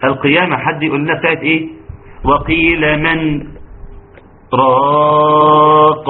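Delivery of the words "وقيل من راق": long-drawn "راق" after a brief stop on "من"; measured Quranic recitation with a pause before "راق"; saktah recitation. Shown as a demonstration. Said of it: "دول أربع سكتات واجبة".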